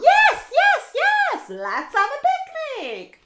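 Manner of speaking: squealing animals thrilled about the picnic; excited cheer. Acted. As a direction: cheer like happy animals agreeing.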